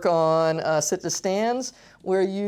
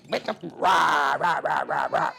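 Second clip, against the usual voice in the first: playful growled rah rah; mock dinosaur roar; 3 semitones lower, slower than usual. Therapist voicing toy dinosaur for child, mock roar.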